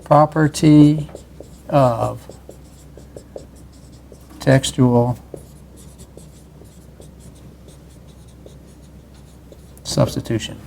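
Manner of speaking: slow, word-by-word dictation; each word drawn out and separated; dictating. Shown as a demonstration. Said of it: "so this is a, quote".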